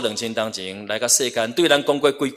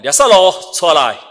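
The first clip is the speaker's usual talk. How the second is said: raised, commanding shout to summon someone; loud call; 6 semitones higher, 9 dB louder than usual. Retold or imitated. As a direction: call out loudly, higher than usual. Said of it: "就大声喊叫讲".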